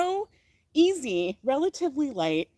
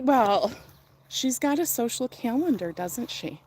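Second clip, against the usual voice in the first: normal talking voice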